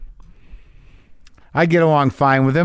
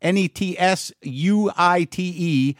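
upbeat sponsor read, higher pitch; 6 semitones higher than usual. Acted. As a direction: read it like a podcast ad. Reads a sponsor ad.

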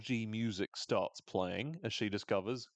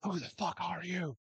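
yelling; 10 semitones higher than usual. Retold or imitated.

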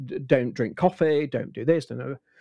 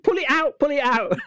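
high, laughing cry to pull it out; hammed-up urgent alarm, pitched way up; mock panicked doctor; 11 semitones higher, 3 dB louder than usual. Doctor panicking to pull the camera out, high laughing alarm. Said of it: "she said".

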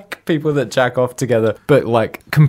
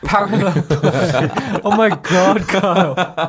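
laughing; 9 semitones higher, much slower than usual. Real, not acted. Friend cackles at the phrase.

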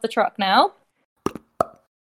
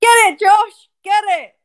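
shouting; 7 semitones higher, 4 dB louder than usual. Acted. Firefighters urging at a blaze.

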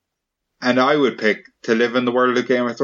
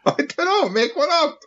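high wailing cry; Susie Soccer crying. Acted. Host voices Susie Soccer sobbing over her friend, high pitched. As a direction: wail his name like a heartbroken girl.

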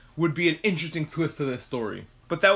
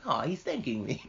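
touched little voice; 4 semitones lower, 6 dB quieter than usual. Retold or imitated. Touched character quoted in recap.

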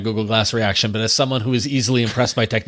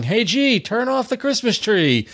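put-on high, slow little kid voice; imitating a small child talking to Google; preschooler voice; 10 semitones higher, slower than usual. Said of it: "a little kid voice going".

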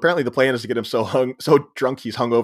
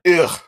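disgusted ugh; 6 semitones higher, 4 dB louder than usual. Real, not acted. Disgust at Jazz fans.

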